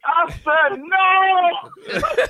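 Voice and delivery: high, loud wail through laughter; scandalized outcry. Retold or imitated. Client protesting the fart in the room, scandalized outcry. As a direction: cry it out way up high, cracking up. Retelling horrified reaction to the fart.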